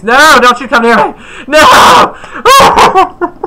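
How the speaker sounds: high, loud playful panicked shout breaking into a laugh; mock panic. Gamer fleeing an enemy player, loud high laughing mock panic. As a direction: yell at the player chasing you, half laughing. Enemy chasing him in game.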